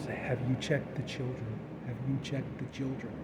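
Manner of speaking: a stranger breathing down the phone line; quiet sinister voice repeating the question; creepy caller whisper. Retold or imitated.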